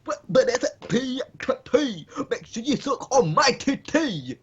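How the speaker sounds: slow, squeaky put-on delivery; silly high-pitched voice. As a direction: draw it out in a goofy squeak.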